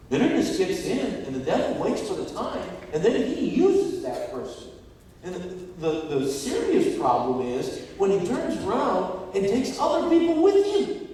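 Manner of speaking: loud, high, driving sermon delivery; preaching. Real, not acted. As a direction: preach it at full force. Preaches at full force.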